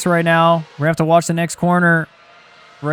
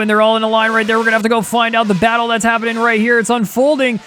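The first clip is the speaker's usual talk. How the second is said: higher-pitched, driving race commentary; excited play-by-play; 5 semitones higher than usual. Real, not acted. Commentator follows the slipstream battle, excited play-by-play. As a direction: build the battle up for the viewers. Live call of a close battle.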